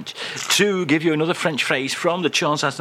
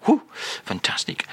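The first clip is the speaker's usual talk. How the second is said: ordinary talk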